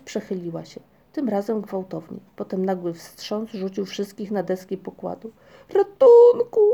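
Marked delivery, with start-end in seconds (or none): 5.7-6.6 very high, loud cry for help; panicked scream. A narrator's reading. Ryjek calls for help as the boat lurches, screaming. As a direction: scream out in alarm. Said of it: "krzyknął Ryjek".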